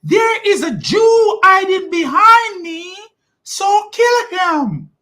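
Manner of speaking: high, strained shouted stone voice; a high far-off screech of a snitching stone; shouting stone. Pretend. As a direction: yell it as the talking stone, very high. Stone speaking, mock voice.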